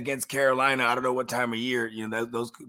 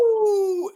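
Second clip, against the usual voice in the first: high howl in the middle of the line; a quick demo wolf howl; 4 dB louder than usual. Shown as a demonstration. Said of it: "Say, "Sam".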